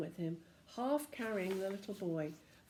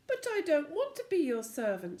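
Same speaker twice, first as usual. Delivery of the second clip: put-on protesting boy; 7 semitones higher, 5 dB louder than usual. Joe protests.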